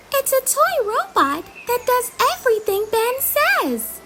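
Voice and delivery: put-on high young fairy voice; Holly voice. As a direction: explain it as a little fairy. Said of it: "explains Holly".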